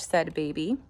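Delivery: ordinary delivery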